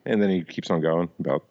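normal talking voice

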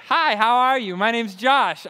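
loud, high, bouncy hello; chipper morning greeting. Shown as a demonstration. Josh greeting people at seven AM, overly chipper. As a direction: overeager sunny greeting. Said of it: "I'm highly annoying at seven A M".

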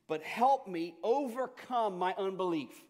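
pleading cry. A narrator's reading. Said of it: "the father instantly cried out".

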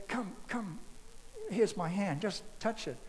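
Jesus softly inviting Thomas to touch his hand; gentle with Thomas. Retold or imitated. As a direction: gently coax Thomas closer. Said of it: "he's so gentle with Thomas".

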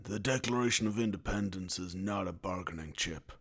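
low, soft, grave film-hero line; Nicolas Cage. Acted. Film hero answering an accusation of bad bargaining, mock-solemn. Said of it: "when Nicolas Cage is accused of".

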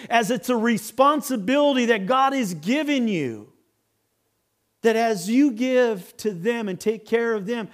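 impassioned exposition with stressed key words; preaching. Real, not acted. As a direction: drive the scripture home to a congregation. Expounds scripture in raised preaching voice.

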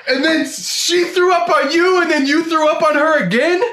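very high, incredulous squeal; appalled disbelief. Acted. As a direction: recap it in high-pitched, outraged astonishment. Friend appalled at retold vomiting.

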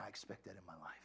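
soft, low, to yourself; quiet confession. Shown as a demonstration. Preacher modeling a quiet morning confession. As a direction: murmur it like a private vow. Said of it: "And you say".

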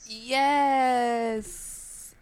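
normal talking voice

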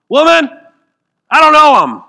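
high, loud flat-out denial. Acted. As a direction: throw the denial back hard. Peter's denial acted out.

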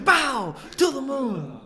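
high, loud cry of "Pow! To the moon"; Christopher Walken impression. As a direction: shout it high like a giddy Walken. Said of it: "mine from that scene is when he goes".

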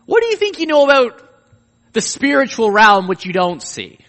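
God rebuking Job over the unseen realm, loud and high; booming God rebuke. Acted. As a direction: confront Job with it, building to a peak. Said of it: "he's showing Job".

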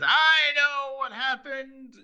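high, slow, drawn-out reveal; hammed-up accuser, stretching every word; mock tattling announcement. Voicing Asa exposing Larry.

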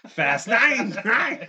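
high loud yell while laughing; laughing shout. Real, not acted.

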